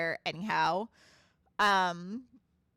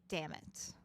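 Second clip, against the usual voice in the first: muttered curse; 7 semitones lower, 11 dB quieter than usual. Acted. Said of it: "Edwin saying".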